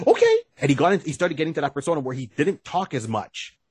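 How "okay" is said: high, loud squeaky okay; high-pitched yelp in imitation; mock sidekick reply. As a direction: squeak it out loudly, acting the sidekick. Acted reply in retold scene.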